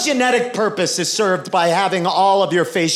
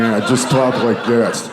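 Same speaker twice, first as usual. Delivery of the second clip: low, creepy put-on voice; deep, unappealing weirdo voice; Buffalo Bill; 7 semitones lower than usual. Shown as a demonstration. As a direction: drop it down into an off-putting creep's voice. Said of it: "taking on that voice like Buffalo Bill".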